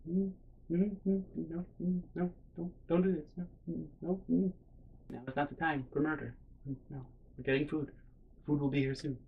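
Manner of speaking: soft, halting little no's; hushed dissuading. Retold or imitated. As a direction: gently talk someone down under your breath. Soothing friend out of murder.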